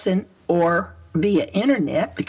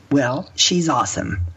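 ordinary talk

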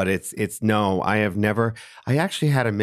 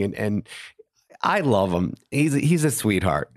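ordinary talk

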